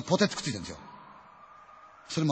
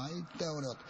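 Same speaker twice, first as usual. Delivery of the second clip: soundless murmur; 4 semitones higher, 9 dB quieter than usual. Retold or imitated. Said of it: "声出てないの".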